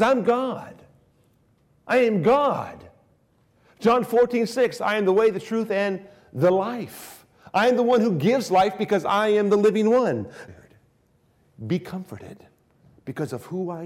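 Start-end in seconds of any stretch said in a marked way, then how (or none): 0.0-10.3 raised, louder proclamation, higher pitch; fervent preaching. Acted. Preacher declaring Christ's words to the congregation. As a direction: proclaim it with full conviction.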